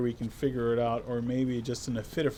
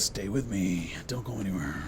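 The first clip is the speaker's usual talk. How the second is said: whispering; 6 semitones lower, 3 dB quieter than usual. Real, not acted. Hushed teasing to viewers.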